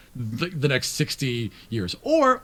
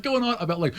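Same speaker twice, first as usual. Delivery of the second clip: normal talking voice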